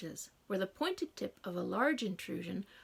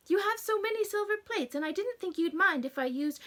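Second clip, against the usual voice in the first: put-on wounded girl; 9 semitones higher, 4 dB louder than usual. A narrator's reading. Wounded Emma explains.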